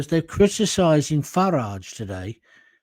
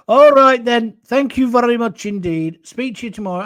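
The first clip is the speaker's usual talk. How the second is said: louder and higher thank-you; hearty goodbye; 7 semitones higher, 6 dB louder than usual. Real, not acted. Streamer thanking his viewers at the end, hearty. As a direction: beam a big cheerful farewell.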